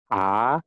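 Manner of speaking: A gliding up at the end; rising tone A. Shown as a demonstration. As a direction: sweep A upward. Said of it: "bacanya juga naik". (A bernada naik)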